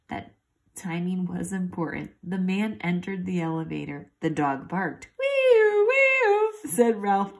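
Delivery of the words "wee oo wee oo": very high, loud siren; loud high wee-oo siren; siren wail. A narrator's reading. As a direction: blast it out like an ambulance siren. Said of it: "hard enough and fast enough".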